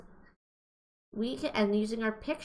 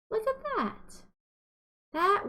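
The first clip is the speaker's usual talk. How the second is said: amazed look; 12 semitones higher than usual. Acted. Teacher points at colored word.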